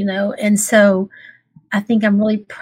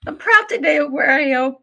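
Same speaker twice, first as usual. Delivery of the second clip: real tearful voice, pitched higher, catching; happy tears; 5 semitones higher than usual. Real, not acted. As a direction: speak through real tears of pride. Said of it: "It's happy tears".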